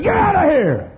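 furious high-pitched yell, hammed up; full-voiced, very high angry holler; mad shout. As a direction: drive the devil out with a yell. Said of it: "got so mad at the devil".

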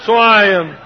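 ordinary delivery

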